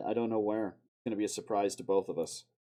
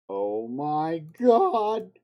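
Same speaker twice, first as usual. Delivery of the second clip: drawn-out, loud oh my god, pitched up; startled cry; 6 semitones higher, 10 dB louder, much slower than usual. Real, not acted. Streamer stunned by his own edit, slow loud gasp of disbelief. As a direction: blurt it out, stunned. Shocked by perfect edit timing.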